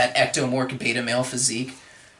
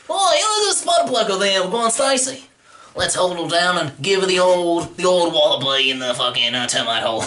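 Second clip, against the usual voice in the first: Australian bully. Pretend. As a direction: jeer like an Aussie thug.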